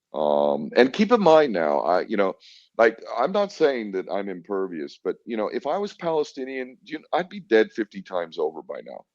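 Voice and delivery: ordinary delivery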